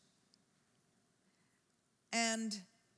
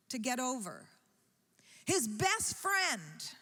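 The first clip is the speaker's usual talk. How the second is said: rising preaching; 6 semitones higher than usual. Acted. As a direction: drive the point home to the congregation. Preacher building to a climax.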